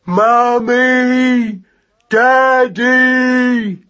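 high, loud drawn-out yell for parents; yelling at the top. Acted. Lost young mouse calls for his parents, yelling. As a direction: call out to the skies, high and loud. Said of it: "yelled at the top of his voice".

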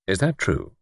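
ordinary delivery, nothing marked

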